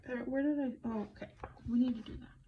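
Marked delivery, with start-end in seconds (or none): none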